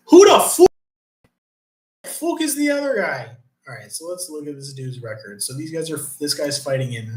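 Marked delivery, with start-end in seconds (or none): 0.1-3.4 much higher, much louder stunned cry; real baffled outburst. Real, not acted. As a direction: yelp in disbelief at the card. Baffled by unknown fighter's name.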